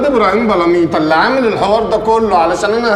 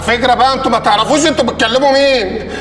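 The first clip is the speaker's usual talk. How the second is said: worked-up threat; 8 semitones higher than usual. Acted. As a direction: blow up in a hammed-up rage. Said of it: "انا معلش انفعلت شوية بس". (تهديد منفعل بصوت أعلى وأقوى)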